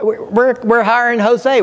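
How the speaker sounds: a half-heated echo of telling HR off; retold outburst. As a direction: replay the moment you went ballistic at HR. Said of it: "I go ballistic".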